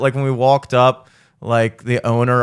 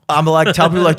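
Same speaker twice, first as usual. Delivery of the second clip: ordinary talk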